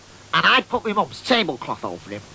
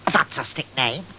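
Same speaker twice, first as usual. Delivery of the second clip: ordinary talk, nothing marked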